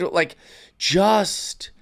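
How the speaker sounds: normal talking voice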